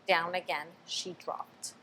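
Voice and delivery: usual speaking voice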